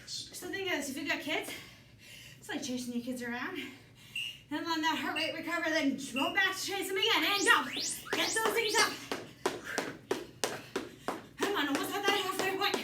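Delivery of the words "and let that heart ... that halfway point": aerobics instructor. Acted. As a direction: fire up the class. Coach pushing class mid-round.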